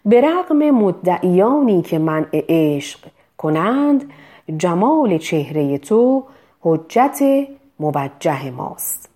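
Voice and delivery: slow, measured poem recitation; slow verse recital. Shown as a demonstration. Teacher reciting verse to practise the word چهره. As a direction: read the couplet slowly, stressing چهره. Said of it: "کلمهٔ چهره".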